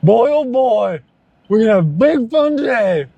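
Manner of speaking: high, mock-cheery moping voice; sing-song gloom like a sad party host; lightly voiced gloomy cheer from UHF; quoting the movie. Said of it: "That's also from U H F".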